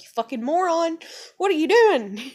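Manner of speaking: high, loud acted scolding; mock mad woman. Woman to man who made it worse, mock-furious scolding. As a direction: yell it in exasperation, about to laugh. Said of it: "the woman gets really mad at him".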